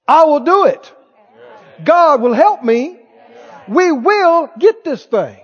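loud-edged, resolute faith; victor talking. Acted. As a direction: vow it with full conviction. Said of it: "How does a victor talk?".

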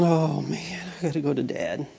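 put-on low, hushed guilty kid mumbling to himself; dreading the walk, voice sinking low and soft; sheepish child, quiet. Child dreading going to dad, low guilty murmur.

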